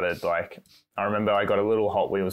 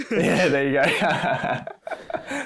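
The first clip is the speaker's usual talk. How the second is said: laughing; 8 semitones higher, 5 dB louder, much slower than usual. Real, not acted. Laughing at the Stroll jab.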